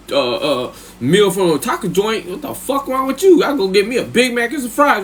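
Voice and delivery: high, squawking disbelief at the price; outraged inner voice. Retold or imitated. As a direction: rant like the stingy half of his head. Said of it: "Half of my head was like".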